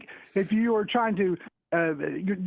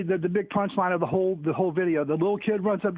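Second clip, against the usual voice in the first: usual speaking voice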